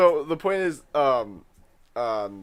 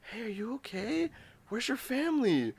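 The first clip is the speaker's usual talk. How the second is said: higher, softer concerned guard; security guard; 10 semitones higher, 8 dB quieter than usual. Retold or imitated. Imitating a guard asking a crying kid, higher and soft. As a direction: check on a lost kid gently. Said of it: "he goes".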